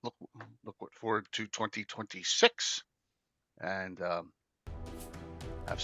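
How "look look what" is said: ordinary talk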